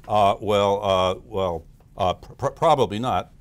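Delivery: loud, low, stammering reply; acted blustering hesitation, stuttering; stumped negative voice. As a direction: hem and haw as the cornered inner critic. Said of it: "a conversation with your negative voice".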